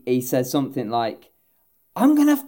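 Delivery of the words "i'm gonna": loud, high mock rage; full pissed. Tram driver hunting whoever wrecked his tram, mock fury. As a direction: vow revenge, hammed up. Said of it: "He's just full pissed now".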